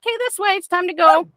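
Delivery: cheery call. Shown as a demonstration. Leaving the park cheerfully.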